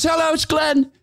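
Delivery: high, bright put-on phone greeting; Glenn's voice. Glenn answering the phone to Todd's brother, in a high put-on voice. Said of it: "He picks up and goes".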